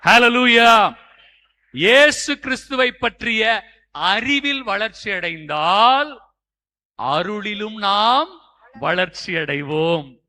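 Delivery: loud, slow, stretched proclamation; forceful preaching. Real, not acted. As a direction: proclaim it with ringing Hallelujahs. (முழங்கும் பிரசங்கம்)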